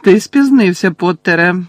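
high, loud, icy reproach; cold. A narrator's reading. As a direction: reprimand coldly and sharply. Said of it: "холодно промовив Снейп".

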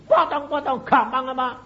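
high, loud retort; acting out his own fierce comeback; retold defiant yell. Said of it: "aku ya teriak gitu".

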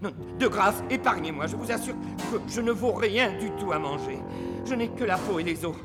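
stammering, hurried pleading; frightened. Acted. Clown begging a bear to spare him, stammering fear. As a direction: plead for your life with nervous little laughs. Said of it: "il donna des sensibles marques de sa peur".

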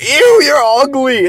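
high, drawn-out mocking squeal; slow, high "ew" of mock disgust; disgusted girl impression. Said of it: "she was like".

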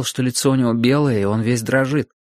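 plain reading voice

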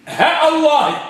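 high, short "ها", then "الله" with a clear glottal stop; short vowel, hamza kept. Shown as a demonstration. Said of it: "نحذف المد". («ها» بلا مدّ و«الله» بهمزة قطع)

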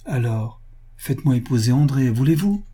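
ordinary delivery, nothing marked

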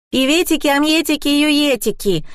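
put-on high-pitched excited child's cry; Kolya calling out. A narrator's reading. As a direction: chirp it out like an eager kid. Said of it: "закричал Коля".

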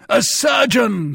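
high, loud, drawn-out shout; desperate cry. A narrator's reading. Captain crying for a surgeon, shouting in alarm. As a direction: call out for help in alarm. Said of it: "cried Monsieur de Tréville".

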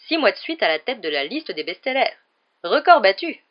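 boastful. A narrator's reading. Lockhart boasting loudly.

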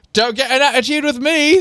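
high-pitched, indignant scolding; glaring telling-off. A narrator's reading. Stepfather warns the girl, indignant telling-off. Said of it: "glaring at her".

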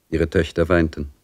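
ordinary delivery

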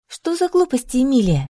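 sharp rebuke. A narrator's reading. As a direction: snap at her impatiently. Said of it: "прикрикнула на неё Агнеса".

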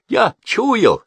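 high, loud triumphant outburst; excited servant raising the alarm; triumphant accusation. A narrator's reading. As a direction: crow over a caught thief, loud and high. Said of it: "с торжествующим видом".

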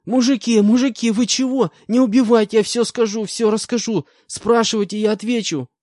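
very high, loud terrified begging; young con begging not to be shot; sobbing plea. Acted. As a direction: beg for your life, sobbing. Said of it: "начал рыдать парень".

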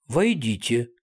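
resonant, pleasant voice; звучный приятный голос. A narrator's reading. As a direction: call them in with a pleasing, sonorous voice. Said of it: "раздался звучный и не лишённый приятности голос". (звучным, приятным голосом)

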